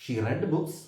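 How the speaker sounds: "read" said as red, short e; "read" rhymes with bed here; past tense read. Shown as a demonstration. Teacher repeats the past form to students, "read" as red. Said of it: "pronunciation മാറ്റം വരുന്നു".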